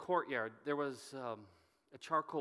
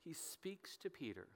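whisper; 2 semitones higher, 10 dB quieter than usual. A narrator's reading. Narrating Jesus' tender moment.

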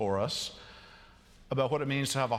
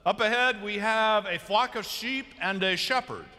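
lightly voiced driver's announcement, raised pitch; bus driver; 7 semitones higher than usual. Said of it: "the bus driver turns and says".

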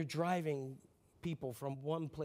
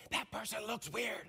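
slow, high, too-audible stage whisper; dad's failed whisper; 5 semitones higher than usual. Retold or imitated. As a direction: imitate Dad whispering for everyone to hear. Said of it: "Dad never knew how to whisper".

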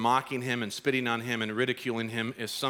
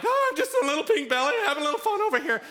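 very high, sheepish squeak; caught innocent; 5 dB louder, faster than usual. Retold or imitated. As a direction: play it innocent when caught red-handed. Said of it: "So I'm like".

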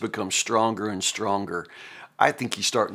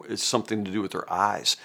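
ordinary talk

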